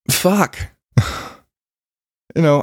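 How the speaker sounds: usual speaking voice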